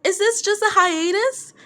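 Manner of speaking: high, pleading cry from the front row; fan shouting a question. Acted. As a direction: call out, hoping. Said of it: "Somebody from the front row shouted".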